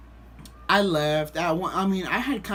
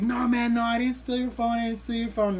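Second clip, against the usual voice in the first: higher-pitched protest of innocence; imitated pleading denial; 6 semitones higher than usual. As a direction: deny it insistently, voice raised. Imitates accused stranger's denial.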